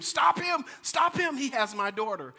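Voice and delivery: high shouted cry for help; retold scream. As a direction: yell it after the running thief. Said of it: "began to scream".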